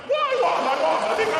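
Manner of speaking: very high, loud squeal; amazed triumph. Acted. Posh non-fighter who just beat an intruder, squealing amazement. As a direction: squeal in astonished victory.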